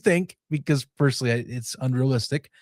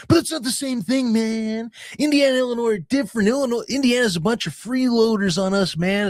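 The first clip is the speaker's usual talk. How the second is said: mock whiny fan; 6 semitones higher than usual. Chicago fans voiced complaining.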